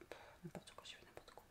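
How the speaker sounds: breathless whisper scolding herself. Real, not acted. Caught in a slip mid-vlog, real whispered self-reproach. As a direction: whisper it under your breath, annoyed at yourself. Muttering to herself about mistake.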